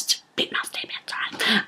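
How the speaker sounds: whispered aside; whispered aside about the rented house. Real, not acted. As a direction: confide it softly as an aside. Confiding about the musty house.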